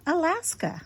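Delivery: usual speaking voice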